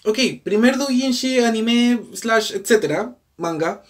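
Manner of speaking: agudo y exagerado; entusiasmo irónico. Real, not acted. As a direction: celebra con sarcasmo. Celebración irónica. (emoción burlona)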